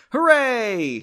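high, loud hooray; victory cheer. Acted. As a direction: cheer like you just won. Ted throws arms up in victory.

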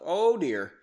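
plain reading voice

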